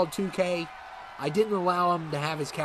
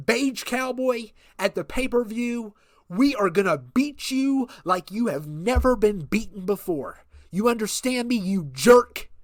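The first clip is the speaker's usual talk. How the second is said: louder, higher taunting threat; trash-talk threat; 5 semitones higher, 4 dB louder than usual. Acted. Manager threatening Beige Cowboy on the mic, angry trash talk. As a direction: get in his face on the mic.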